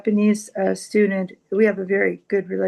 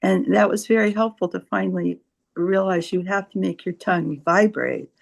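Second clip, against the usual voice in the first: normal talking voice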